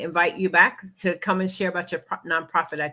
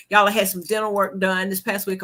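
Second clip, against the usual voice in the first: normal talking voice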